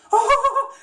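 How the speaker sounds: high cartoon titter; Shaggy nervous laugh. Shown as a demonstration. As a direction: laugh scared through the nose and breath.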